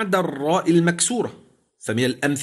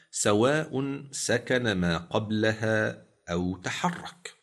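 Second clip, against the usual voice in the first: slow, measured chanting of the line; poem recitation; 6 semitones lower, 6 dB quieter, slower than usual. Shown as a demonstration. Sheikh quoting the Jazariyya verse, slow chant. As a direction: recite the verse slowly, every syllable weighed.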